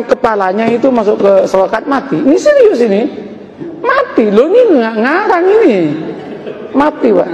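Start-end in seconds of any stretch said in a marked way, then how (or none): 2.2-7.3 comic high-pitched insistence; insistent. Real, not acted. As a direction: insist it's true, voice climbing high. Insists to audience it's true.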